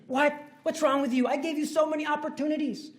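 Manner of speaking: high, loud exasperated scolding; hammed-up divine outrage; mock indignant rebuke. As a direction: scold them with disbelief. Lord rebukes, voiced.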